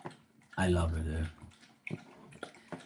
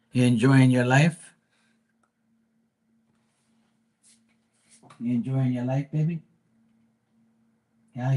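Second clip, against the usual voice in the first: doting pet talk; 3 semitones higher than usual. Real, not acted. Owner to his dog Diamond, cooing baby talk. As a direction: coo at the dog with rising questions.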